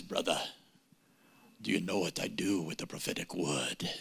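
deep, low preacher voice imitating Mike; impression of Mike. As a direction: drop the voice low like an old African pastor. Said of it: "I'm not gonna do voice impersonations".

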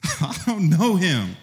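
high, comic put-on denial; mock denial. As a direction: ham up Peter's lie. Mocking Peter after laugh.

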